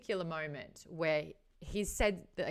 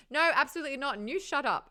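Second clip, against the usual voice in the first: high, loud mock snap; imitating Genya; 8 semitones higher, 7 dB louder, faster than usual. Genya snaps at Nikolai, high and louder. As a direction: fire it back, pitched up and louder. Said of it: "David and Genya scene".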